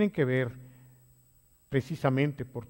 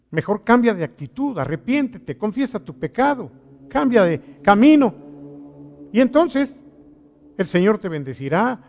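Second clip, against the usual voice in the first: fervent exhortation; 5 semitones higher, 8 dB louder than usual. Acted. Preacher exhorts congregation.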